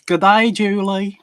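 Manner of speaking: loud, high attempt at an Australian accent; badly faked Aussie greeting. Greeting a co-host in a terrible Australian accent. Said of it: "that was the worst Australian accent".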